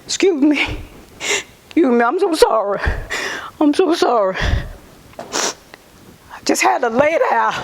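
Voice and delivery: fighting back tears, apologizing; real emotion overwhelming her testimony; real tearful apology. Real, not acted.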